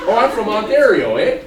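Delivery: loud mock Canadian accent. Tourist claiming to be from Ontario, mock Canadian accent. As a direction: answer in put-on Canadian with a tag "eh". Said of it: "with a little bit of an A".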